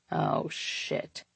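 hushed, sinking dread; dismayed whisper. A narrator's reading. Man realizing he is caught out, whispering. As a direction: let it out under your breath, unvoiced.

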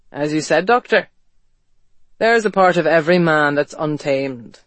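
dry, broken self-mocking tone; mock himself, voice fractured; dry self-mockery. A narrator's reading. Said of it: "His voice was dry and fractured with self-mockery".

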